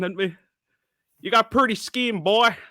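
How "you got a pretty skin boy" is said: high, mock Southern American accent; loud, high Southern American impression. Shown as a demonstration. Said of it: "the Southern American one".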